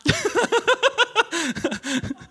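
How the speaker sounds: a long, high burst of laughter. Real, not acted. Pastor cracking up after imitating Jesus, long high laugh. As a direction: crack up at your own joke.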